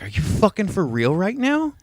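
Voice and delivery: 1 incredulous. Retold or imitated. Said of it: "and I was like".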